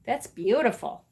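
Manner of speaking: ordinary delivery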